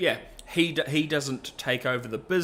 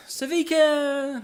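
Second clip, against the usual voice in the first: high, slow dramatic name; 14 semitones higher, 5 dB louder, slower than usual. Real, not acted. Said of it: "repeats name dramatically".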